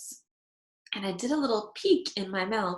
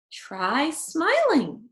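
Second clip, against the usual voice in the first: encouraging grandpa; 6 semitones higher, 3 dB louder, much slower than usual. A narrator's reading. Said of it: "said Grandpa".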